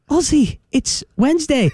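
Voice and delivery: put-on a child correcting a muddled dad; high kid voice explaining gently; mock kid answering.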